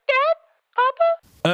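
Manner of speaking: put-on very high child voice calling for dad; tiny girl's voice calling Dad; little girl on phone. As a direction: squeak it out like a small kid. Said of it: "you can hear a voice like".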